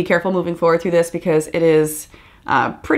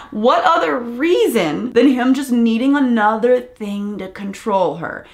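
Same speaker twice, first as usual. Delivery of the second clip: ordinary talk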